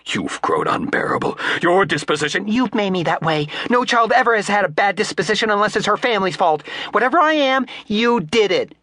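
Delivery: high-pitched angry flapper; defiant retort. A narrator's reading. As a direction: throw the blame back.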